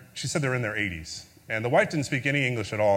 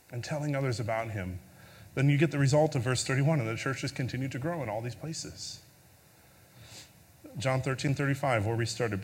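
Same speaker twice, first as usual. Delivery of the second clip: usual speaking voice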